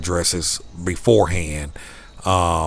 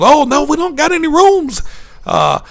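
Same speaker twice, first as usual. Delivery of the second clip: high, louder mock Canadian clerk; Canadian accent; 6 dB louder than usual. Hotel lady saying no rooms, high Canadian accent. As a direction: keep the chirpy Canadian lilt throughout. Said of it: "I can't make the accent".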